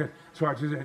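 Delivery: ordinary delivery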